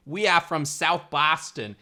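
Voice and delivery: put-on slow, loud, laboured Boston accent; strained Boston accent. Actor struggling to put on the accent, overdone Boston. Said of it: "really struggling to put it on".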